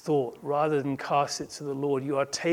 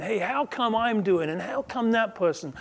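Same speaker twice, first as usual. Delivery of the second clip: mock complaint, pitched up; complaining; 6 semitones higher than usual. Believer griping about someone else, complaining. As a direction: protest in a raised, aggrieved pitch. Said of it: "I'm complaining".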